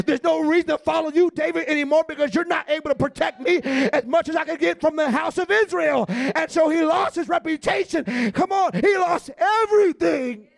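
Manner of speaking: loud, rising preacher's exhortation; fiery preaching. Acted. Pastor exhorting congregation about David's losses, full-force preaching. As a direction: drive it home to the congregation.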